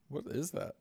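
ordinary delivery

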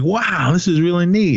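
high, gushing mock wow; retold admiration. Hunters admiring the gun, mock admiration. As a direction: imitate a very impressed hunter.

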